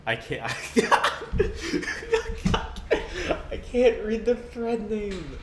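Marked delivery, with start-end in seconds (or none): 0.5-3.5 wheezing high-pitched laugh; helpless squeal of laughter. Real, not acted. Cracking up reading thread. 3.5-5.4 high, slow, laughing words; laughing while talking. Real, not acted. Laughing too hard to read.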